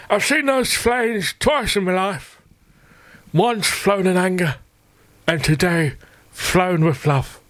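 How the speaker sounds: put-on high, slow, frail old man; old veteran voice. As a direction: quaver it like a proud old soldier. Mimicking old veteran's tribute.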